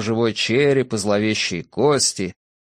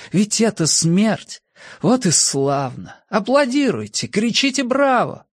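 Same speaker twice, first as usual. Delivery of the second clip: frenzied ecstasy; 9 semitones higher than usual. A narrator's reading. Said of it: "неистовая мысль".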